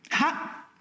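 a light, high exclamation; exclaiming. A narrator's reading. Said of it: "esclamò lei".

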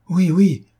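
an excited yes, yes; eager cry. A narrator's reading. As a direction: cry out, suddenly lit up. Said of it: "s'écria le jeune homme".

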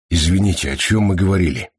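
plain reading voice

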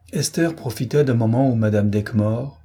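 ordinary delivery

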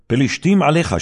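high, loud urgent warning cry; alarmed call. Acted. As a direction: shout the warning to jolt him awake. Delilah calls out to wake Samson. (קריאת אזהרה רמה ודחופה)

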